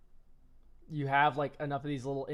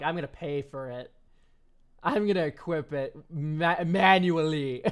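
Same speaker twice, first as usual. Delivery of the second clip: mocking, dragged-out whiny quote, the last word louder; mock player quote; 7 dB louder than usual. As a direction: imitate a clueless player, stretching manually. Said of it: "and you're like".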